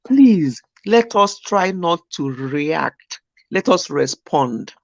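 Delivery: slow sincere counsel; slow, earnest advice. Real, not acted. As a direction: spell it out gently. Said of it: "advice spoken slowly".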